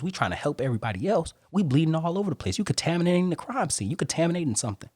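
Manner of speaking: rising fervour about bleeding wounds; building passion. Real, not acted. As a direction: preach it harder and harder.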